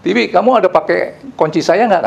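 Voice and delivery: mild mock call to someone upstairs, pitched high; retold calling out. Employer asking helper Tiwi about keys, a mild retold call. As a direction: imitate calling through the house without really shouting. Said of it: "saya teriak, dia teriak lagi".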